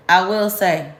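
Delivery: ordinary delivery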